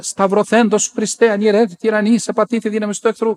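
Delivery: alarmed prayer. Retold or imitated. Startled nun praying against the apparition, alarmed. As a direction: mimic a startled plea against evil.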